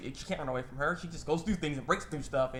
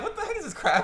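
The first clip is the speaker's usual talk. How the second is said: high-pitched baffled exclamation; amused disbelief; 13 semitones higher, 4 dB louder than usual. Retold or imitated. Viewer reacting to the weird scene, high incredulous outburst. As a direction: squawk it in comic bewilderment.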